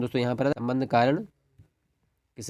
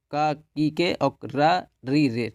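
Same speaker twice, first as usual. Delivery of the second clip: slow dictation; much slower than usual. Shown as a demonstration. Teacher dictates the case endings.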